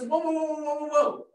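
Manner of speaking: high run of alarmed whoas; halting whoas. Retold or imitated. God stopping Nathan after his hasty promise, halting whoas. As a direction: cut in with a rapid, high mock-alarmed whoa.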